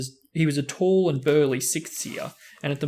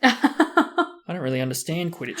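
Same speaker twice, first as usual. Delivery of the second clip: breathless; 4 semitones higher, 5 dB louder than usual. Acted. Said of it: "said Colin breathlessly".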